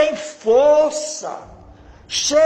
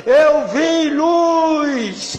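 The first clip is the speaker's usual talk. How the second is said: slow, stretched, louder cry; retold shouting; 4 semitones higher, 4 dB louder, much slower than usual. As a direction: shout it out in tearful awe. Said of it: "Aí o homem veio gritando, chorando". (grito lento e emocionado)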